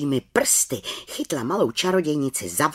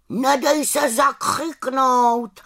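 high, slow raven's farewell call; calling after her; 12 semitones higher, 4 dB louder, slower than usual. A narrator's reading. Raven to departing little witch, calling out goodbye. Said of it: "volal za ní na rozloučenou".

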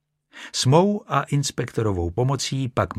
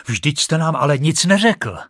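raised, higher-pitched protest; exclaiming; 5 semitones higher, 5 dB louder than usual. Acted. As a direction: call it out in protest, louder. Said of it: "zvolal doktor".

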